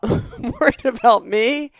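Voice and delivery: laughing through the words, slowed; amused laugh at herself. Real, not acted. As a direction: giggle while admitting the worry. Laughs through own worry.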